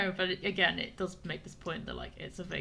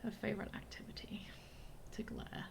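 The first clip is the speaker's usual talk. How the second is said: whispering; 9 dB quieter than usual. Real, not acted. Whispered aside while playing.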